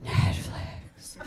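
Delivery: breathy tempting whisper of Netflix. Pretend. On a bad Tinder date, Netflix calling, a soft whisper. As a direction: hush it like a siren call. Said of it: "you hear it just like".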